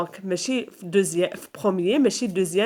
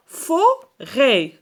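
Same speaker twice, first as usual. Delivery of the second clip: fo... rêt in two slow beats; syllable-by-syllable; 3 semitones higher, 3 dB louder than usual. Shown as a demonstration. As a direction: split forêt clearly in two. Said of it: "عندنا جوج مقاطع".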